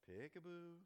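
put-on soft, slightly high peekaboo to a crying child; playful peekaboo. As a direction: lilt it gently upward to coax a smile. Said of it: "that crying kid ... and you're like".

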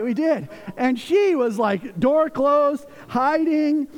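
ordinary talk

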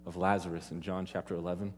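ordinary talk

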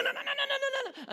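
very high, loud squealed noes; hammed-up kid afraid of sinking; mock panicked child. Child panicking again in swim lesson.

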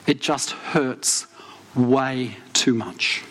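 slow, low, weighted admission of pain; worn-out hurt. Retold or imitated. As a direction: let each phrase drag with restrained hurt. Retelling his breakdown to his wife.

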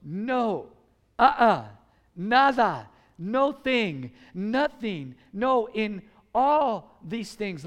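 emphatic denial, each word set apart; slow, punched-out refusals. Retold or imitated. Preacher giving Paul's answer to the congregation, emphatic. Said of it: "Emphatically, he says".

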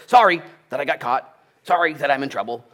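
loud, high-pitched grudging sorry; hammed-up apology that isn't meant; mock insincere apology. Someone caught out saying sorry, loud and insincere.

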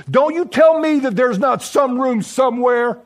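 husband shouting at the innkeeper; angry shout. Retold or imitated. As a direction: shout him down, fists on his tunic. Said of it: "grabbed the innkeeper's tunic with a tight grip and said".

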